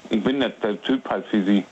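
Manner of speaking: normal talking voice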